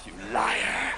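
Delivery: very low, soft jab; deep, hushed mock scolding; mocking accusation. Mocks the stoner's false belief.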